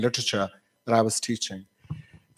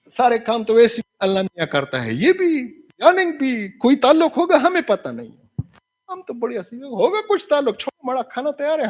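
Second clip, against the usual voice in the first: high-pitched comic Hindi musing; mock credulous shrug; 9 semitones higher, much faster than usual. Teacher acting someone unbothered, dinner is ready, high mock musing. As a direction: act out waving away a puzzle in a high voice.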